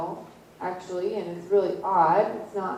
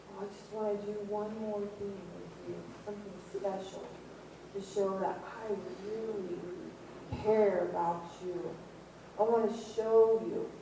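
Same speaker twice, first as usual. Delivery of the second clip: hushed, gentle farewell words; tender whisper-soft; 9 dB quieter than usual. Retold or imitated. Jesus telling disciples he loves them, hushed and tender. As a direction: speak softly, like a last loving goodbye.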